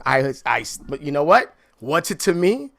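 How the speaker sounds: ordinary talk, nothing marked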